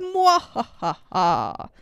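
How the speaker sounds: slow hammed-up evil laugh; mad scientist. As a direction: cackle like a cartoon villain. Evil laugh after Sith Lord.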